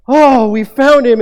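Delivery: loud, slow, relieved joy; the parents find their lost son and rejoice; overjoyed relief. Retold or imitated. Parents finding Jesus, overjoyed.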